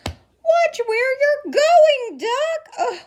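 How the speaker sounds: high, slow, fussing hen voice; high-pitched flustered chicken with a laugh; scolding hen. Acted. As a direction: squawk it out like a startled hen. Scared chicken's thought.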